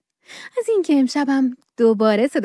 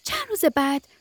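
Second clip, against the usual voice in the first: put-on gentle bedtime story reading for kids; children's radio storyteller; storytelling narration; 4 semitones higher than usual. A narrator's reading. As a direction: read it like a bedtime tale.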